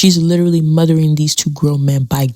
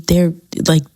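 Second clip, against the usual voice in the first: ordinary talk, nothing marked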